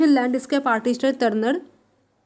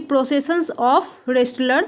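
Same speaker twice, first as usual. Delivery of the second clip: slow dictation; much slower than usual. Shown as a demonstration. Teacher dictating English title.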